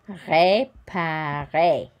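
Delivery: "réparer" very slow as ray-pa-RAY, final R silent; "réparer" stretched syllable by syllable; slow model word. Shown as a demonstration. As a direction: model "réparer" slowly for students. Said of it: "you've got the A sound".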